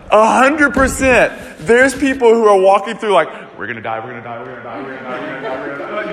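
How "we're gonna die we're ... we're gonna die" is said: mock panic. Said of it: "freaking out".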